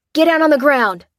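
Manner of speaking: high, loud barked order; soldier's command. A narrator's reading. Hostile soldier ordering inmates down, loud shout. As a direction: command them to the ground. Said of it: "came a cry from the first truck".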